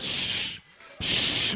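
long hissed shh, twice; imitated shushing. Crowd hushing Bartimaeus, shushing. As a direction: shush someone who is too noisy. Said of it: "told him to shut up".

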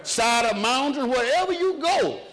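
fervent preacher lifting the whole church; preaching at full force. Acted. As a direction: build the sermon up to a high ringing cry.

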